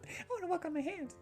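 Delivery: put-on very high-pitched little girl voice; excitable girl character. Child in dancing lesson begging to play, high child voice. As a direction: pipe it up high like an eager child. Acted child figure in game.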